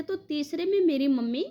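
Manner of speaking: usual speaking voice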